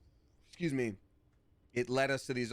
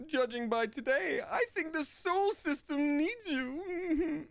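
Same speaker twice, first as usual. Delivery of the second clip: much higher, slow character voice; much slower than usual. Acted. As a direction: deliver it high and slow. Voicing player character dialogue.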